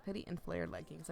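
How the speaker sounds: normal talking voice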